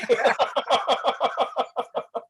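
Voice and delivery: high delighted burst of laughter; laughing hard at the compliment. Real, not acted. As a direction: let a high happy laugh ring out.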